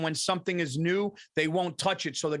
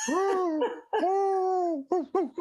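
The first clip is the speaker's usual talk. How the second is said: high-pitched doggy woos; imitated dog howl; 13 semitones higher, much slower than usual. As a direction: howl like a wary dog looking around. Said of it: "he's looking around. He's going like".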